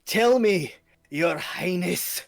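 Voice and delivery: high, loud, slow on Your Highness; stepping closer as he presses the king; menacing demand. Acted. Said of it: "as he starts stepping closer".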